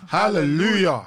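loud high hallelujah; congregation shout. Pretend. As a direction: answer like a congregation. Congregation response.

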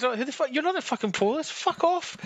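mock outrage. Imitating the wife yelling at the game detective, mock outraged. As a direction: pitch it up and play the fuming wife. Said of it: "she was just outraged at it".

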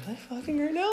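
very high, thin disbelieving voice; incredulous squeal. Retold or imitated. Teller replays overhearing his parents, high incredulous squeal. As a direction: squeak it in mock horror.

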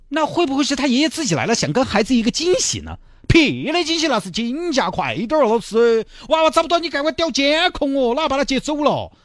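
mother demanding the teacher check the cameras, high and frantic. Acted. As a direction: push it up high, urgent and alarmed. Mother panics over missing child.

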